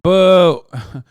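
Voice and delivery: jeering boo; mock boo. Real, not acted. Streamer booing the senator in the clip, jeering.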